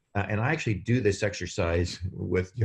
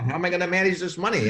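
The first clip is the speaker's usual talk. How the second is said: stressed lottery winner; 9 semitones higher, 3 dB louder, faster than usual. Retold or imitated. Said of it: "You'd be stressed about".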